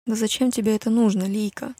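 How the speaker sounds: usual speaking voice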